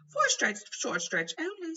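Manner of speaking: high, loud flustered minister. A narrator's reading. Fudge pleading with Dumbledore, high and loud. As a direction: plead like a harried official. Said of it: "not meeting Hagrid's eyes".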